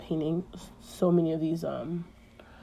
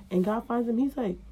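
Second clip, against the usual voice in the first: normal talking voice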